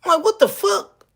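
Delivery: ordinary talk, nothing marked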